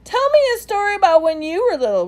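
put-on high, fast eager pleading child; pleading little elephant. A narrator's reading. Ruby pleading for a story, high child voice. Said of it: "Ruby pleads".

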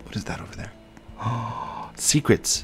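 hushed, secretive whisper. Pretend. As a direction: whisper like finding treasure. Hushed discovery.

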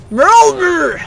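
loud, high growled-out name; exaggerated loud cry; mock bellow. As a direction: belt out the silly name. Shouts dead body's odd name.

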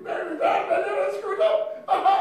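very high, loud squawk; mock shrieking. Acting out the clash for laughs, mock shrieking. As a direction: shriek it in a put-on voice. Acted shrieking in comic threat.

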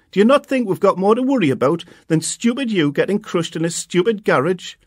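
high, loud telling-off; mother snapping at her son from the door; scolding mum. A narrator's reading. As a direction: scold him for not waiting.

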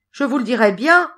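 small hero calling out. A narrator's reading. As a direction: pipe up so the men hear you. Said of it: "leur cria Tom Pouce".

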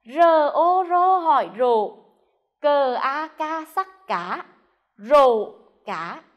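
teacher spelling rổ cá aloud. Shown as a demonstration. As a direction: spell it out clearly for children to copy. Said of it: "Cùng đánh vần theo cô".

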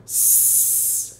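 s hissed alone, no vowel; a short hissing s with nothing added; pure s sound. Shown as a demonstration. Teacher showing the s sound, hissed only. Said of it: "beginning with the sound".

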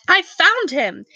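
usual speaking voice

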